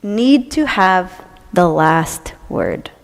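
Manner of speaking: drawn out low and slow, savoring it; slow soft relishing; slow quiet relish. Real, not acted. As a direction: lean back and savor each word. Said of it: "I love this one".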